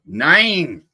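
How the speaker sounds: loud, high German outburst; angry German elf. Acted. German elf refusing Santa's plea, angry shout. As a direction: cut Santa off with a loud cry. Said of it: "He always sounds angry".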